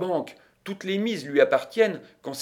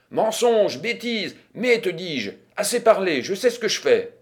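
raised-pitch, irritated retort; impatient dismissal; 6 semitones higher, 4 dB louder than usual. A narrator's reading. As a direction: cut off the objection stubbornly.

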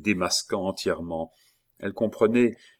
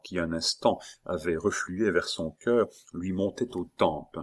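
plain reading voice

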